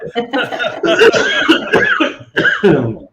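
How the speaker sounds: sudden dry cough fit. Real, not acted. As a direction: cough hard, then catch yourself. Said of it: "desculpa a tosse aqui".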